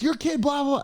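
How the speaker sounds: dismissive blah-blah rant; angry man. Retold or imitated. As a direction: stranger blaming the kid to mom, mock angry. Angry man answers mom.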